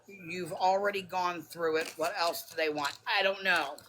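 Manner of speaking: ordinary talk, nothing marked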